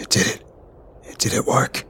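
hushed, hoarse near-whisper. A narrator's reading. Soldier asks if the blast worked, hoarse and hushed. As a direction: falter through a hoarse, barely voiced question. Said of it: "my voice hoarse".

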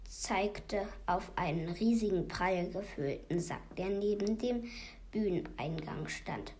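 plain reading voice

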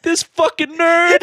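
high slow sneering; mocking. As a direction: sneer it out slowly. Mocking imagined nerd.

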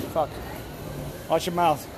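ordinary delivery

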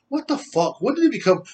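ordinary talk, nothing marked

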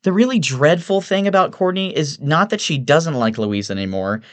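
high-pitched gossipy woman; Evelyn, exasperated socialite. Acted. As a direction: lift it into a dramatic socialite complaint. Evelyn voiced in narration.

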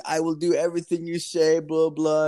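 lightly voiced imitation of a compliant caller; eager scam caller.

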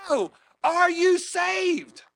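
long, high drawn-out holler; high, loud mimicked shout; imitated top-of-lungs yell. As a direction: yell it slowly over the machines. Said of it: "he's yelling at the top of his lungs".

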